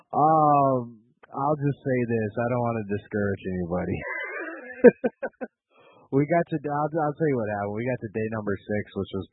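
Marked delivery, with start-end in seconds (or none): 4.0-5.5 long high laugh trailing down; high burst of laughter. Real, not acted. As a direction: laugh high, then let it fall away. Laughing again before telling the story.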